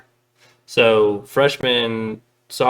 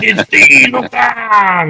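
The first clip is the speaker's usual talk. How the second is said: much higher and louder, slow hammed-up yell; mock action-hero shout; 12 semitones higher, 8 dB louder, much slower than usual. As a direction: bellow it like a cartoon action hero. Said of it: "he's just, he's cranking".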